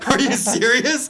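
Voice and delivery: can't believe it, laughing; laughing disbelief. Retold or imitated. Quoting his reaction to friends' pity, laughing disbelief. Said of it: "I'm like".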